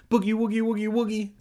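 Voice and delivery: high, fast, loud silly chant; playful teasing. Acted. Reader hams up Kermit's electric fence, silly chanting. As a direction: rattle it off high and quick.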